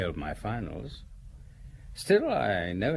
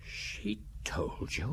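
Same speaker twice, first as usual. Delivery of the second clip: stunned whisper; 4 semitones lower, 7 dB quieter, much slower than usual. Acted. Secretary shaken by news.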